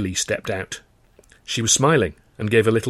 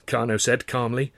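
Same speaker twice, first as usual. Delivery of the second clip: ordinary delivery, nothing marked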